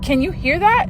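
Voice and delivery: high-pitched, checking he hears it too; retold disbelieving question. Asking boyfriend about screams only she hears, retold disbelief.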